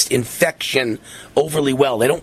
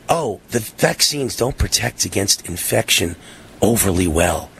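mock politician calm; 8 semitones lower than usual. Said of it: "Can I translate into real English".

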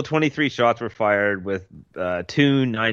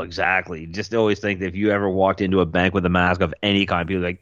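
usual speaking voice

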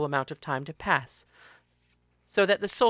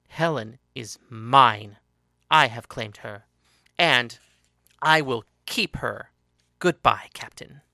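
slow, low and louder, each word laid down; cold claim; 3 semitones lower, 5 dB louder than usual. Acted. Killer to dying captain, slow heavy menace. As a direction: possessive menace spelled out slowly. Declaring claim while strangling.

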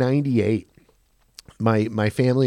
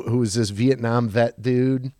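ordinary talk

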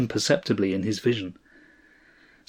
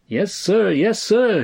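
high-pitched fairground barker's cry; raucous hawker; 10 semitones higher, 4 dB louder, slower than usual. A narrator's reading. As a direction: call it out high like a street vendor. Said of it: "faint and raucous declarations of the vendors and hawkers".

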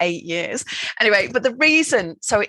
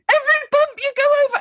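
pained cry; 3 dB louder, faster than usual. Retold or imitated.

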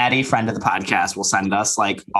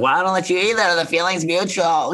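high mock voice; 8 semitones higher than usual. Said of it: "She's like".

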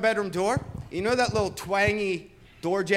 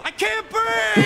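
put-on high whiny kid's yell, much higher; mock yell; 13 semitones higher, 4 dB louder, much slower than usual. Comedian imitating his little brother yelling out. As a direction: wail it like a little brother faking distress.

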